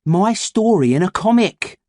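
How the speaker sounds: put-on proud young boy; boy character voice. A narrator's reading. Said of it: "pounded the door".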